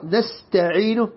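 نستعين with the final vowel held two counts; two-beat ending. Shown as a demonstration. Quran teacher showing students the correct two-count stop. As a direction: hold the end of نستعين just two beats. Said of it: "حركتين".

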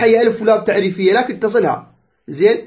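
usual speaking voice

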